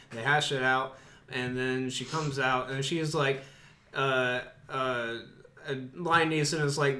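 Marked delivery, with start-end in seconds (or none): none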